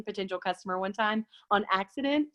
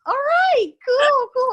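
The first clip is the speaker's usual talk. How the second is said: much higher and louder forced cheer; mock awkward cheer; 8 dB louder, much slower than usual. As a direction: chirp it way up, awkwardly bright. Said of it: "I'm like".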